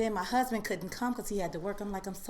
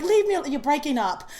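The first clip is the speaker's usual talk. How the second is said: high, loud playful dismissal; mock brush-off; 7 semitones higher, 9 dB louder than usual. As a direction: fake a bad line to dodge him. Brushing husband off on phone.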